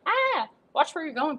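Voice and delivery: high yelp into an annoyed retort; startled yelp. Acted. Girl bumping into a man, startled and high-pitched. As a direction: yelp high, then tell them off.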